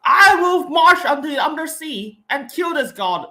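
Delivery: loud, high, slow heroic declaration; dramatic delivery. Shown as a demonstration. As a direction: proclaim it like an epic hero. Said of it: "it's supposed to be like".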